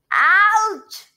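high, loud yelp; drawn-out cry of pain; yelling in pain. A narrator's reading. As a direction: yell out as if hurt. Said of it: "he yelled".

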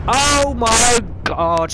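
high, loud, slowly stretched "oh my god"; startled outcry. Real, not acted. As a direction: cry out at the shock. Startled by mic hit.

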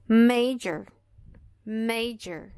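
major, MA stressed, j as dj; MAY-jer, first syllable strong. Shown as a demonstration. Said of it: "Aqui em major".

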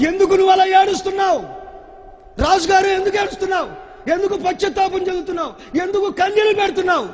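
loud, high and fast, pressing the king; fiery preacher's shouted questions; shouting questions. Acted. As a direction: demand answers at full volume.

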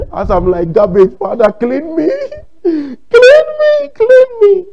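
high, loud mock sobbing plea; hammed-up tearful praying, shooting up high; imitated weeping prayer. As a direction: wail it like an overwrought worshipper begging. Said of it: "Some may be weeping and shaking".

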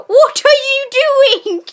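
high, loud disbelieving yell; alarmed shout. Real, not acted. Yelling at his friend crashing the car, high and loud alarm.

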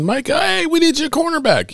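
very high-pitched ad-skit coach; quick high call to a player; imagined coach calling. Pretend. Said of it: "I can see in the ad".

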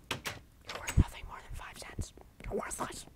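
breathy whisper, no voice at all; whispered taunt. Acted. Mocking voice whispers the insult at himself. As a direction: hiss the insult close to the ear.

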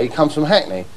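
ordinary talk, nothing marked

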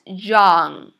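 put-on low, drawn-out YOUNG, falling; model pronunciation. Shown as a demonstration. Teacher models a word for learners, slow model pronunciation. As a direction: stretch YOUNG out loud and clear for learners. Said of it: "Repeat after me".

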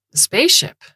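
high, loud, impressed question. A narrator's reading. Dowd reacts to Moocher's war story, impressed. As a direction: jump up in amazed inquiry.

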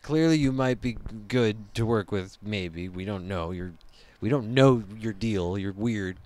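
a lightly voiced wary boss talking down to a recruit; skeptical agent voice. Acting agents sizing up the recruit.

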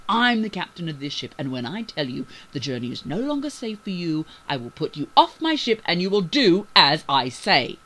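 loud, commanding threat; threatening growl. A narrator's reading. Captain orders the crew off his ship, threatening. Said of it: "became as threatening as he could, growling".

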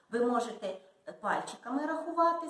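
ordinary delivery

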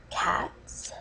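cats breathed out in a tiny voiceless whisper; choked whisper. Acted. Fake dating video, choking up on cats. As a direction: barely get the word out, choked up. Overcome saying she loves cats.

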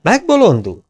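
high incredulous question; can't believe it; astonished. Acted. Mexican youth reacting in disbelief, high.

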